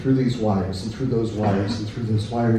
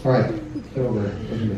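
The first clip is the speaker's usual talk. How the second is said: ordinary talk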